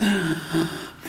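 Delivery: high gasp of wonder; amazed gasp. Retold or imitated. Showing a kid his carving, amazed gasp. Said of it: "I was like".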